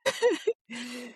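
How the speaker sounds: high giggle; laugh. Real, not acted. Guest amused at the "Yes, Mom" joke, laughing.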